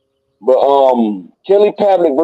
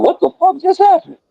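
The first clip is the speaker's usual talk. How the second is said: stunned disbelief. Retold or imitated. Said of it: "Everybody was like".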